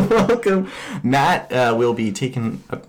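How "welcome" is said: laughing through the word. Real, not acted. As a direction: giggle it out. Laughing welcome.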